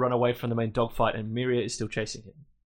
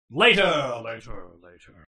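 dismissive later; 3 semitones lower, 5 dB louder, slower than usual. Retold or imitated. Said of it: "when she goes".

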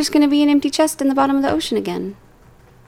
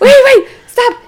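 laughing excitement; 11 semitones higher, 5 dB louder than usual. Real, not acted.

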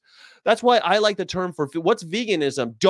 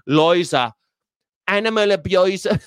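hammed-up Australian accent, louder; 3 semitones higher, 4 dB louder than usual. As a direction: toss it off like a brash Australian. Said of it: "she says it like an Australian".

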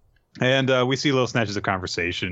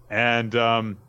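ordinary talk

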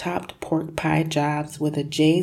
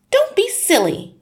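wife's voice; 7 dB louder than usual. Acted. Wife scolds husband over hat.

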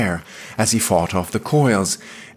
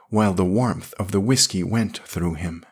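ordinary delivery, nothing marked

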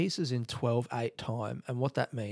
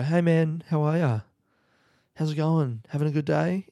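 loud, chirpy higher hello, hiding dark thoughts; over-friendly greeting; 5 semitones higher, 7 dB louder than usual. Retold or imitated. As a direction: greet the housemate with bright cover-up cheer. Fake friendly greeting to housemate.

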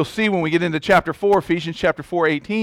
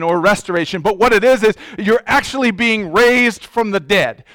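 higher, louder, driving pulpit delivery; forceful preaching; 3 semitones higher, 4 dB louder than usual. Real, not acted. Preacher proclaiming resurrection to congregation, forceful and raised. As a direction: build into emphatic, urgent proclamation.

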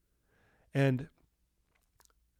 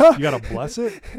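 loud, incredulous question about the hot dogs; hammed-up surprised rookie; mock disbelief; 13 dB louder than usual. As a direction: act baffled that food needs a blessing. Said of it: "I'm like".

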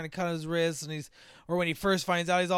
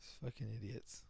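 hushed whisper of disgust; whispered aside; 6 semitones lower, 16 dB quieter than usual. Real, not acted. Mocking the dumb movie victims, whispered. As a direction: breathe it under your breath.

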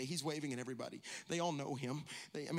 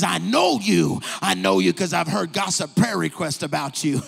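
laughing pastor; 3 semitones higher, 13 dB louder than usual. Acted. Pastor greets Mary, laughing.

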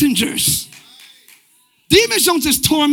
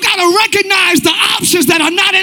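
higher, insistent shouted exhortation; full-force preaching; 5 semitones higher than usual. Real, not acted. Preacher urging believers to guard their purpose, full-force preaching. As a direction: press the congregation hard, phrase after phrase.

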